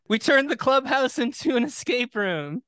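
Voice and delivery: lightly voiced team players, high and laughing; a hint of goofy jock; mock players' voice. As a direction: play a giddy ballplayer announcing plans.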